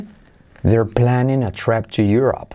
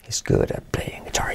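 whispered model sentence; 7 dB quieter than usual. Shown as a demonstration. Teacher whispers the sentence for students to repeat. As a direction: breathe the line with no voice. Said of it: "¿Conmigo?".

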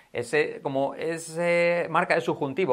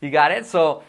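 usual speaking voice

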